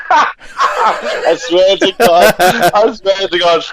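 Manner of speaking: swearing it's true through real laughter, high-pitched; drawn-out, high laughing protest; laughing insistence. Real, not acted. Guest swears to host he got the tattoo, laughing.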